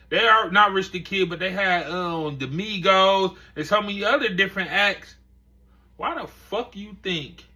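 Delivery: usual speaking voice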